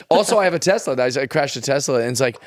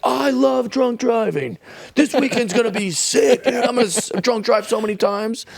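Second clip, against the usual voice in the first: high, hammed-up party-bro brag; mock party bro; 9 semitones higher than usual. Mocking an imagined drunk driver as an over-excited bro. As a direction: brag like a clueless party dude.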